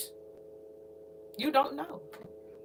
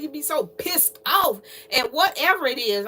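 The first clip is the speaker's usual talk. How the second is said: ordinary talk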